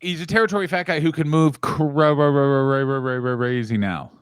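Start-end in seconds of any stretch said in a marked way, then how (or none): none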